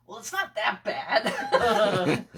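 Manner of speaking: put-on slow, loud, drawn-out old dude; gruff old man voice. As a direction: play a tough old guy shrugging it off. Dust-tough old guy quoted.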